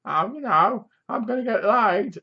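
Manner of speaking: high, hammed-up dopey boast; a lightly voiced dim cast member bragging; mock actor line. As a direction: mimic a bad ad-libbing actor. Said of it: "making up the words".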